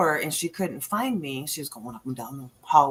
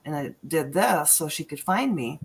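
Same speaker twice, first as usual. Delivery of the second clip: ordinary talk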